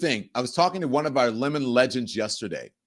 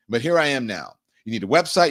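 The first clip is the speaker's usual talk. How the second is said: normal talking voice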